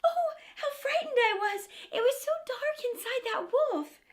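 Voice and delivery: high, breathless crying out; girl freed from the wolf crying out; relieved little girl. Acted. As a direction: gush it out, still shaken.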